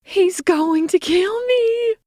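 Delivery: slow, high, loud terrified cry; panicked scream. A narrator's reading. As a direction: drag it out in terror. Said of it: "cried the distinctly feminine voice".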